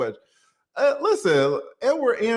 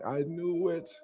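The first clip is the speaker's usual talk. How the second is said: imitating Tasha K; 8 dB quieter than usual. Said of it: "Tasha K being like".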